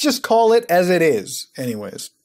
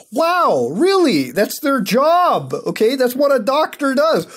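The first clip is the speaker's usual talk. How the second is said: worked-up mock amazement, high and loud; tilted, sarcastic rant; 7 semitones higher, 4 dB louder than usual. Real, not acted. Streamer ranting about lying politicians, tilted and sarcastic. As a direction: rant as if stating the painfully obvious. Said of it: "I'm getting kinda tilted over here".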